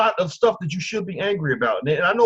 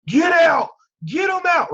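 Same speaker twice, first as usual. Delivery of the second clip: much higher, louder imitated rally shout; mock Trump rally yell; 12 semitones higher, 7 dB louder, slower than usual. Host imitating Trump ejecting people at rallies, mock shout. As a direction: bark it like a rally crowd-pleaser. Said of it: "he would be like".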